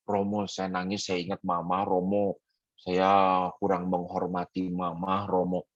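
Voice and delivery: low, soft mock confession; lightly voiced contrite girl answering the priest; meniru anak perempuan. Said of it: "kalau perempuan saya tanya". (tiruan lirih anak perempuan mengaku)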